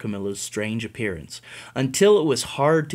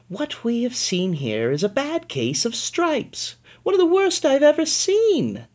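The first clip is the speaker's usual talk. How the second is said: put-on sweet old lady; 11 semitones higher than usual. Old woman diagnosing, high.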